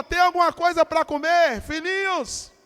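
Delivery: loud tender call; Jesus calling out. Acted. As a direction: call out to his little children. Jesus calls to the disciples.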